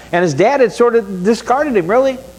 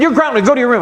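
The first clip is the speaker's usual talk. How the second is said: raised parent voice; 6 semitones higher, faster than usual. Retold or imitated. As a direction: bark it while holding back a laugh. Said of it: "I said".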